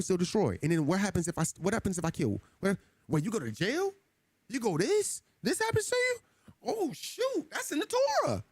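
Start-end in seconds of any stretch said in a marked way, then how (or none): 2.6-8.4 mock shocked realization. Acting out discovering the law.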